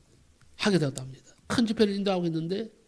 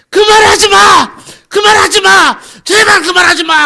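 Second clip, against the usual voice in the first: very high, full-force pleading shout; acted terrified screaming; 13 semitones higher, 20 dB louder, slower than usual. Possessed young man begs pastor to stop, acted screaming. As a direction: scream in desperate protest, drawing it out. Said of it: "소리를 막 지르더랍니다".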